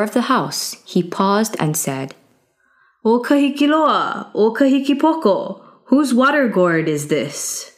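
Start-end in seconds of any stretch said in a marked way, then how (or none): 3.0-7.7 higher, ringing call to the guards; young hero calling out. Acted. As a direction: call them out, bold and pointed. Youth calls to the guards.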